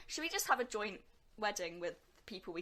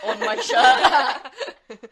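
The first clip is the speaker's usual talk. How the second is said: words giving way to a big laugh; 3 semitones higher, 17 dB louder, much slower than usual. Real, not acted. Friend recalls mum seeing the quote on her shirt, laughing. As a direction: break into loud laughter while talking.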